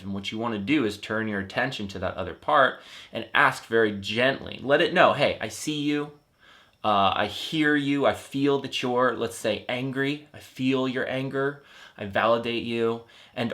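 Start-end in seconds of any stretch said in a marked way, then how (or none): none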